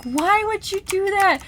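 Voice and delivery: high, drawn-out exasperated plea; conflicted protest. Retold or imitated. Reader questioning the author's choice, exasperated plea. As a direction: whine it up high, slowly. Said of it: "I was so conflicted by that".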